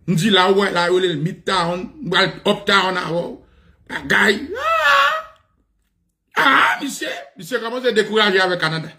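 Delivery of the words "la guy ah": high crying wail on ah; mock wail. Retelling his despair at the Canada news, wailing. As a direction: let out a wailing cry.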